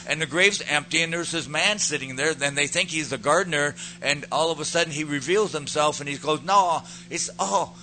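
loud, high-pitched preacher telling; excited preaching. Acted. Preacher telling of the empty grave, loud and excited. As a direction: drive the story forward at full voice.

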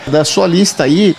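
ordinary talk

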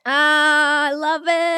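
high, drawn-out delighted reaction; delighted squeal. Real, not acted. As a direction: gush in a high voice at a great pun. Delighted by the co-host's pun.

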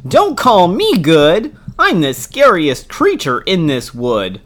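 boasting mouse. Acted. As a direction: puff up and brag.

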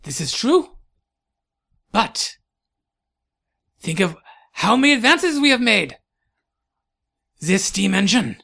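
put-on high, loud exclaiming in a German-French accent; halting, swooping rebuttal with big pauses; accented eccentric scientist. Scientist defending progress to a doubter, German-French accent.

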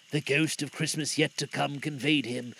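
ordinary delivery, nothing marked

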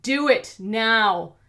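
slow, loud, furious command; villain yells at hero to obey; shouted order. A narrator's reading. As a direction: bark each word slowly. Villain orders hostage threat.

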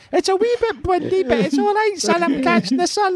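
very high Scottish granny voice; Scottish mother in a t-shirt, very high; Scottish mother impression. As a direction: squeak it out like a Scottish mum sunbathing. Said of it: "That was probably quite a good Scottish accent".

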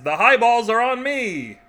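big slow punchline call; hammy giraffe. Acted. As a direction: belt it out like a giraffe buying a round.

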